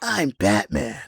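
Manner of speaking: mocking a wrong-sounding superhero voice, drawn out; Lego Batman actor. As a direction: stretch the words slowly in a put-on hero voice. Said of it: "He has too high pitched of a voice".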